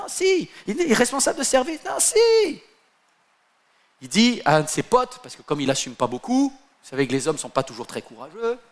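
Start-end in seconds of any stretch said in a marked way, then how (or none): 0.0-2.6 high, teasing sing-song dithering; playful high back-and-forth; singsong hesitation. Acted. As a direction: swing between no and yes in a high mocking lilt.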